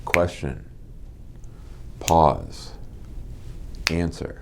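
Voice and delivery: soft, deliberate, one word at a time; hushed, spaced-out cadence; measured rhythm. Shown as a demonstration. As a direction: set a calm beat with long gaps. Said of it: "You sort of wanna develop a rhythm".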